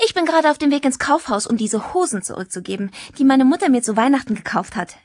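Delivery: put-on high, quick, lively girl's voice; chatty schoolgirl. Tanja explains her errand to Jack, chatty schoolgirl. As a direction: rattle it off as an eager teenage girl.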